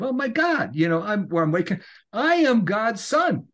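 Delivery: high-pitched, acted-out amazement; awakening revelation. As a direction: relive a sudden astonishing realization. Acting out his moment of awakening.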